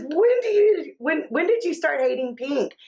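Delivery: high-pitched, stumbling mock disbelief; retold startled question. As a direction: act out a baffled mother questioning her toddler. Retells her startled question to child.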